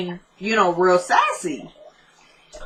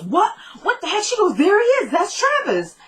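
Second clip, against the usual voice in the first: shocked surprise; 10 semitones higher than usual. Retold or imitated. Kelly spots Travis on screen.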